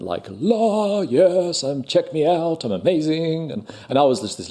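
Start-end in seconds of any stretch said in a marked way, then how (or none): 0.3-3.5 put-on high, showy kid voice; mock confident kids. Shy boy mocking the confident kids at school. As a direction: prance it like a cocky drama kid. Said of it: "all these kids were super confident".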